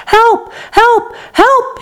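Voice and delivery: high-pitched cries for help; yelling. A narrator's reading. Boy in the chocolate river calls out, yelling. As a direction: yelp for rescue, high and urgent. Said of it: "he yelled".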